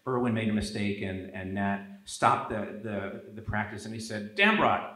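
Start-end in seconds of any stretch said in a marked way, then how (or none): 4.4-4.9 raised, louder coach calling a player out; mock wrathful coach. Coach stopping practice to confront his favorite player, mock wrath. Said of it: "even he didn't escape his wrath".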